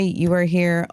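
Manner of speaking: ordinary talk, nothing marked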